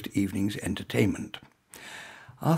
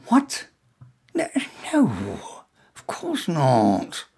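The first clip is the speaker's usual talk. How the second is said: muttered, confused denial; 2 semitones higher, much slower than usual. A narrator's reading. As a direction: caught out, fumbling the denial. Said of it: "my muttered and confused riposte".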